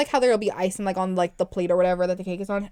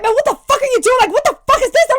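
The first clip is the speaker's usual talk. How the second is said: loud, high retold cursing-out; yelling full volume; 14 semitones higher, 8 dB louder than usual. Older sister cursing out her brother for touching cake, yelling. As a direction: replay the furious yell, pitched way up. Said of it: "I was yelling at, like, full volume".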